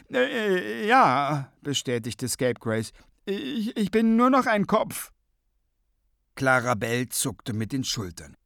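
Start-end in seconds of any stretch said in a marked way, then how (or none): 0.1-1.5 high, halting character voice; flustered head in a jar; stammering confirmation. A narrator's reading. 3.3-5.1 high-pitched, halting character voice; helpless head in a jar; flustered stammering admission. A narrator's reading. Scapegrace stammers his condition.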